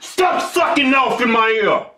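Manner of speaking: slow, loud shout to stop the noise; irritated shout. Real, not acted. Yelling at his cohost to stop slurping into the mic, loud and slow. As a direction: bark it out slowly and loudly.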